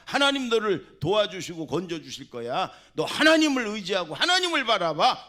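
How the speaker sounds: loud, high, passionate shouting; fervent blessing shout. Shown as a demonstration. 화난 부모가 자녀에게 축복을 외치듯, 뜨거운 큰 외침. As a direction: bellow the blessing over your child. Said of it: "이렇게 욕을 하세요".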